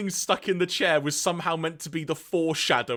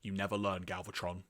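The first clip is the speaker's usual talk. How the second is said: much softer, low to himself; muttered aside; 6 semitones lower, 11 dB quieter than usual. Acted. Sound Blaster to himself as Galvatron leaves, softly. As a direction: say it under your breath. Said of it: "to himself just says".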